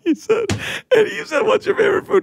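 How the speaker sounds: very high squeaky retelling; high mocking voice.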